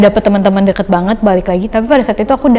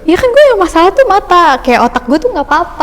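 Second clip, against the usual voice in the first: high-pitched retold protest; indignant protest; 8 semitones higher than usual. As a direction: act out refusing to accept it, voice lifted. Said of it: "tidak terima nih".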